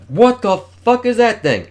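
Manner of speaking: high, loud, slow horrified yell; terrified outcry. Acted. Facing the giant monster, a high, slow, horrified yell. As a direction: cry out at the monster.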